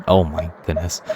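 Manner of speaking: ordinary talk, nothing marked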